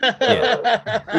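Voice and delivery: laughing through the words; talking while cracking up. Real, not acted. Laughing at the joke.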